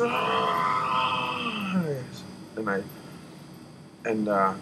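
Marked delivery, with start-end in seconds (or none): 0.0-1.8 sudden high cry of fear; a sharp gasping wail, pitched high; terrified cry. Retold or imitated. Frozen in terror during friend's story, high cry. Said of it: "I just".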